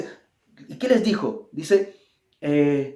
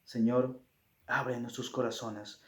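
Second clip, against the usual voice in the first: soft, humble closing prayer; softer pleading prayer voice; 7 semitones lower, 9 dB quieter than usual. Acted. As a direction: pray quietly to the Father.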